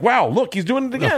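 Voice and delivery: high, sing-out ribbing of a crier; mock teasing. Friends rib a man crying at the table, high mocking tease.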